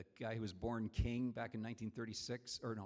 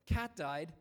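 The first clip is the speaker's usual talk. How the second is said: "Cat died?" raised high in a shocked rising question; disbelieving; 5 semitones higher, 5 dB louder than usual. Retold or imitated. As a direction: repeat it back in disbelief. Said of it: "He was really upset".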